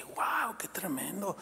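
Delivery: soft unvoiced wow; awed whisper. Pastor retelling his reaction to the video, awed whisper. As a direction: breathe out in awe. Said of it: "Yo dije".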